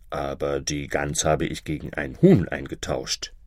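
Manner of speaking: low, understated farmer's voice. A narrator's reading. Farmer tells his wife about the hen, low-voiced. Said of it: "rief der Bauer".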